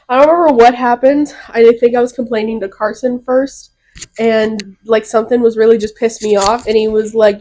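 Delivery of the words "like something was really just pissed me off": ordinary delivery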